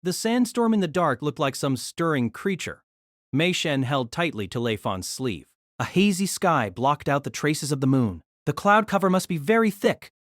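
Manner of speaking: usual speaking voice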